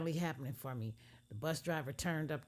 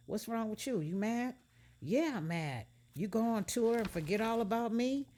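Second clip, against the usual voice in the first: put-on upset girlfriend; 8 semitones higher, 5 dB louder than usual.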